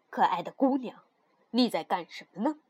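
put-on low, kindly young king; gentle. Young king approaching the girl on the ice, gently. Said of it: "听见这温和的声".

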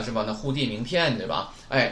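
normal talking voice

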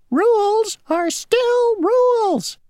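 a little insect drawing itself up, insisting; mosquito shouting. Acted. Mosquito losing patience with Grasshopper, mild high mock shout. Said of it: "shouted the mosquito".

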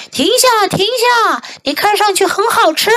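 high-pitched cow's call; crying out. A narrator's reading. Hungry cow calls to the runaway, high-pitched cry. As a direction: call out high to halt him. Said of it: "奶牛大叫道".

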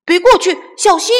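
high loud call to stop; shouting warning. Acted. Chick shouting to friends to stay away. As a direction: yell be careful. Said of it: "小胖墩喊道".

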